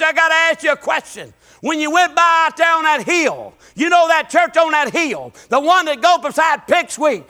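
loud, rising revival preaching; preaching at full force. Acted. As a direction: preach it hard to the congregation.

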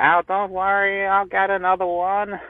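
badly faked accent, high and slow; drawn-out, high-pitched mangled accent, put on for laughs; [name]. Star to pub landlord about her handbag, failed celebrity accent. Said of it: "I don't know what accent that was".

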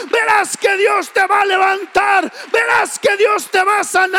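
loud, high, preaching faith to her son; a mother declaring faith over her son, loud and high; fervent encouragement. Retold or imitated. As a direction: lift him up with rising conviction.

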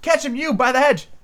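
very high, urgent character voice; high-voiced Rabbit directing a chase; Rabbit calling orders. A narrator's reading. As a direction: call out commands to the others in a high pitch. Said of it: "the rabbit's voice then".

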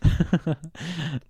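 chuckling at his own joke; corny dad laugh. Real, not acted. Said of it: "you laughing at your own joke".

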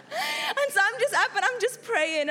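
laughing through the line; amused laugh under the words. Real, not acted. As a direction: chuckle as you tell it. Laughing while telling.

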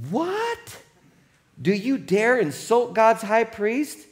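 shocked high "What?", then affronted demand; indignant crowd. Acted. Bystanders rebuking Paul for insulting the high priest, indignant. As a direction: act out an outraged bystander. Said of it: "how the crowd responds".

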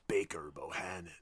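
low, soft, menacing name-drop; imitating a quiet sneer at a rival firm; stank on it. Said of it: "like, a stank on it".

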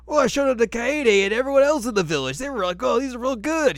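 put-on shrill it out proudly like a small kid; hammed-up excited little boy; loud squeaky kid. Voicing Shippo excitedly.

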